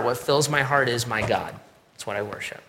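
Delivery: usual speaking voice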